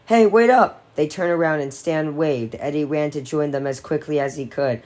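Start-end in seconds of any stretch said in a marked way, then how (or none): 0.1-0.8 high, loud call down the street; shout. Acted. Eddie shouting after his friends, high and loud. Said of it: "he shouted".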